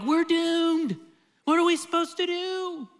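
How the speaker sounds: high-pitched frantic Israelites, hammed up; mock panic. Preacher mimicking panicked Israelites at the Red Sea, high and frantic. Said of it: "They're like".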